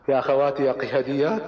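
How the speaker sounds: ordinary delivery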